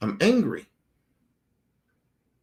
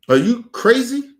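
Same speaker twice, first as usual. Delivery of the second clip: incredulous shout; 9 semitones higher, 6 dB louder than usual. Real, not acted. Family rant, shouted take.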